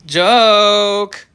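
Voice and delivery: high, loud drawn "Joke!"; loud joke call. Real, not acted. Vlogger shouting that the dream was a joke. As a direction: call it out to everyone.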